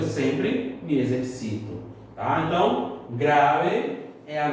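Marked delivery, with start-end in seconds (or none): none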